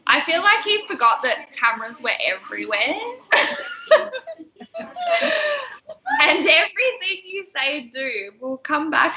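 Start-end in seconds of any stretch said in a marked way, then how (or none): 3.0-6.2 shrieking laugh; big laugh. Real, not acted. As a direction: crack up at the thought of Bill. Laughing at Bill's behaviour.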